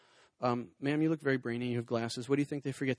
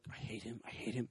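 muttered under the breath, much softer; quiet imitation of a resentful audience member; mock hateful mutter; 4 semitones lower, 13 dB quieter than usual. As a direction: grumble it low as an imagined heckler.

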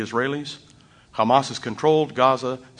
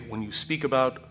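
usual speaking voice

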